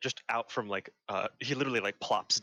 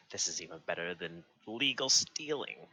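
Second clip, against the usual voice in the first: inner voice. Acted. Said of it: "Mo inside says".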